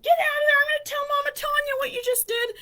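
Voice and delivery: high-pitched retold telling-off; scolding yell. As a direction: re-enact a loud threat to tattle. Scolds supposed brother in story.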